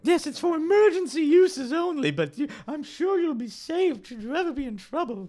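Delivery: put-on a mother soothing her child; crowd mother.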